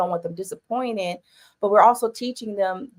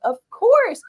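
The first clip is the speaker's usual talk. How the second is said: high, loud "of course"; emphatic assurance; 6 dB louder than usual. Retold or imitated. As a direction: exclaim it with big emphasis. Retold reply to her son.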